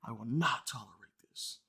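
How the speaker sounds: low, soft menacing voice; hammed-up quiet menace; mock menacing. Reading fan's threatening text.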